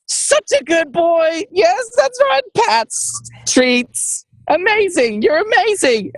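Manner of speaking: puppy praise voice. Acted. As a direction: gush over the good boy.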